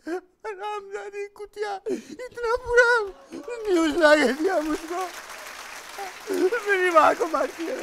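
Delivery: full-out acted hysterical grief; anguished cursing. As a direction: curse the messenger through loud sobs. Cursing the bringer of bad news, wailing.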